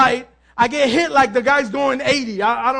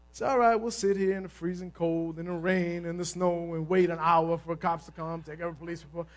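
low sarcastic aside; 8 semitones lower, 11 dB quieter than usual. Retold or imitated. Sarcastic about waiting for cops.

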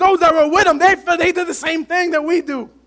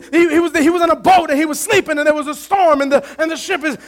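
ordinary delivery

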